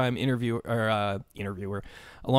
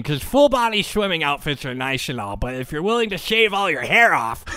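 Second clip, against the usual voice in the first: know-it-all nerd; 2 semitones higher than usual. Acted. Know-it-all swimmer dismissing the question, nerd voice. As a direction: correct them like an insufferable nerd. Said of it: "I'll do the know-it-all nerd voice".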